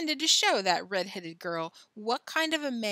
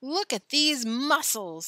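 slow, loud showman's boast; circus ringmaster; 5 semitones higher, 5 dB louder, slower than usual. A narrator's reading. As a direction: show off the strongman, stretching each word. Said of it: "said the ringmaster".

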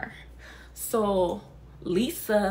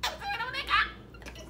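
laughing; 13 semitones higher, 5 dB quieter, much faster than usual. Real, not acted. Laughing at the firefighters' deaths.

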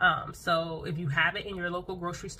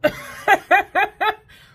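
high, loud giggle; 14 dB louder than usual. Real, not acted. Vlogger laughing about too much turmeric, giggling. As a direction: laugh at your own mistake.

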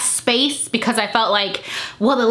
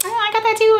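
excited friend; 11 semitones higher, faster than usual. Retold or imitated. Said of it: "someone's like".

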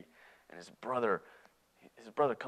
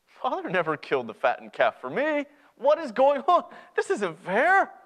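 high, loud, escalating protest; indignant outburst; 12 semitones higher, 6 dB louder than usual. Acted. Older brother seeing the party, loudly indignant. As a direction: erupt like a wronged sibling. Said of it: "he's not full of happiness".

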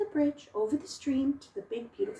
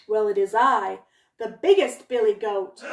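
loud, bold big billy goat; 3 semitones lower, 8 dB louder than usual. Acted. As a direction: answer the troll with confidence. Biggest goat faces troll.

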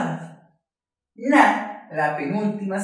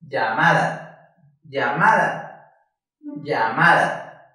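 slow "Llamada", leaning on "ma"; stress on MA; 4 semitones lower, slower than usual. Shown as a demonstration. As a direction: lean into the middle "ma" of "Llamada" each time. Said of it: "Ma es la sílaba que más fuerte suena". ("Llamada" despacio, acentuando "ma")

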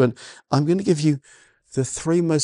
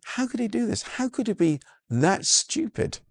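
incredulous; 8 semitones higher, 4 dB quieter than usual. Real, not acted. Exasperated rhetorical questions about servant.

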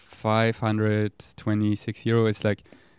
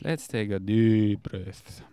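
very slow, drawn-out words; unhurried self-soothing; slow calming breath; much slower than usual. Real, not acted. Calms himself with a breath.

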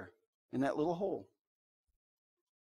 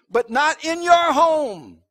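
slow, loud, and much higher on every word; emphatic preaching; 6 semitones higher, 7 dB louder, slower than usual. Real, not acted. Preacher warning the folks, slow and loud. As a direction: hammer the warning home slowly.

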